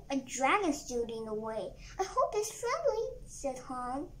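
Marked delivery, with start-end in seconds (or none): 2.0-3.1 anxious hope. Acted. Dragon blocks the children's way.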